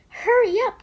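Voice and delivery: high urgent call. Acted. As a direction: urge the child on. Mother urging child.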